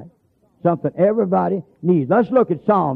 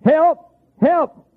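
high, loud distant cry for help; hollering for help; 12 semitones higher, 4 dB louder than usual. Retold or imitated. Lost man in the night wilderness, hollering for help. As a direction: call out long and high through the dark. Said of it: "I heard somebody hollering".

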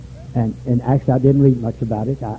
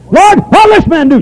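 voiced Peter; 9 dB louder than usual. Quoting Peter in sermon.

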